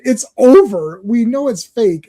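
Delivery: high, loud mock exasperation; exasperated outburst. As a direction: scold deluded believers in comic disbelief. Exasperated rant at believers.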